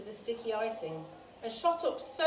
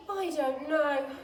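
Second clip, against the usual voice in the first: sighing; 5 semitones higher, 6 dB louder, slower than usual. A narrator's reading. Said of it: "sighed Alice".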